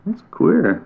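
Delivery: a cheerful small animal setting him straight; chipmunk. A narrator's reading. Chipmunk puzzled.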